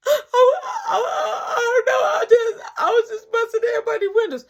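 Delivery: high, loud sobbing stammer; a boy blubbering through his excuse; crying stammer. Retold or imitated.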